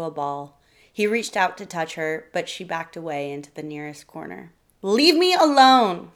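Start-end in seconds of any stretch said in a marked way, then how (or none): 4.8-6.1 slow, loud furious snap; wife snapping at husband to back off; snapped. A narrator's reading. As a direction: snap it out, each word hard and loud. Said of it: "Sarah snapped".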